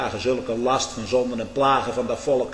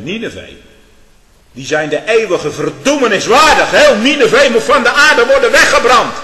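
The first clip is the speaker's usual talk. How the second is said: high, loud cry of the king's decree; full-force preaching; 8 semitones higher, 13 dB louder than usual. Acted. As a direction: shout the call to repentance. Preacher voices king's call to repentance. (uit volle borst preken)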